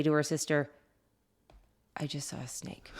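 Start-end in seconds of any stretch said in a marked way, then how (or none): 2.0-2.9 breathy hushed whisper, no voice; retold whisper. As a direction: whisper it like a scared kid. Child reporting snake, retold.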